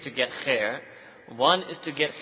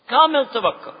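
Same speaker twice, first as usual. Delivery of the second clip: raised, louder emphasis on Kamil; emphatic preaching; 9 semitones higher, 10 dB louder than usual. Real, not acted. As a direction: punch out Kamil tawakkul. Preacher stressing key term.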